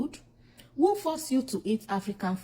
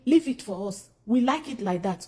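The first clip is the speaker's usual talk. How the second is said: ordinary talk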